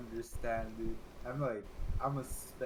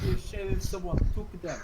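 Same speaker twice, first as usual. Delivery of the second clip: a hint of roadman, louder; lightly mocked London street voice; london roadman accent; 8 semitones higher, 7 dB louder than usual. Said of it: "What about the roadman, dude?".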